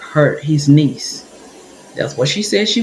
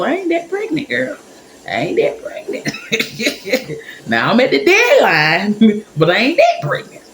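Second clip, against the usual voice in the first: high-pitched, incredulous and giggly; laughing protest; 7 semitones higher than usual. Retold or imitated. Talking back inwardly to the old lady, laughing disbelief. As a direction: object in mock outrage, breaking into laughs.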